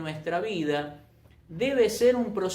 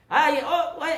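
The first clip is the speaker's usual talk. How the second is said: playful, higher-pitched "ooh, look at that"; mock surprise; 6 semitones higher, 4 dB louder than usual. Preacher teasing the congregation about raised hands, mock surprised. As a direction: tease with exaggerated little exclamations.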